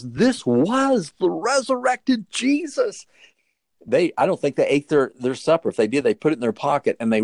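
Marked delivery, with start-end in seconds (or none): 0.0-3.1 slow, high, hushed wonder; marvelling at the risen Lord; awestruck. Acted. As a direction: let the wonder of it slow you down. Awed climax of Emmaus story.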